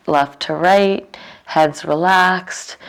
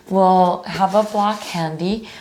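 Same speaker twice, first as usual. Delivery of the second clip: usual speaking voice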